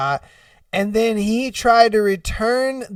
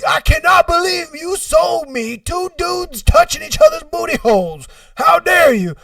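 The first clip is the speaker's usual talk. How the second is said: high, scandalized complaint; hammed-up outrage; 9 semitones higher, 4 dB louder than usual. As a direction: confront the clerk in overblown indignation. Buyer confronts the clerk.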